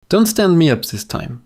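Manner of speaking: normal talking voice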